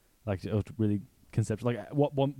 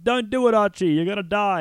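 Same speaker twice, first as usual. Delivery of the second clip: high, loud retold plea to stop; acted-out panicked yell; alarmed warning shout; 9 semitones higher, 7 dB louder than usual. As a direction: cry out a warning, pitched way up. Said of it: "and Doctor Doolittle's like".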